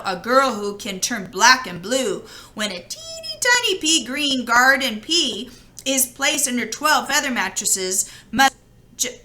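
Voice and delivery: plain reading voice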